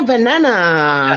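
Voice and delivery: slow, low, savoring each syllable; mock-solemn slow banana; drawn-out banana. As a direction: stretch the word out like a joke. Repeating the hashtag word, drawn out.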